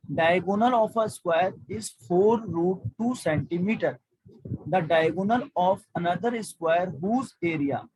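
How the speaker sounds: very slow, word by word; slow reading. Shown as a demonstration. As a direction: read the question out slowly. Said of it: "आराम से पढ़ रहा हूँ". (बहुत धीरे पढ़ना)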